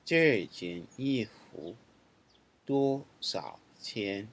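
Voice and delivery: slow, clear teacher reading of the sentence; slow model reading. Shown as a demonstration. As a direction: model each word slowly for learners. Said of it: "let's look at some example sentences".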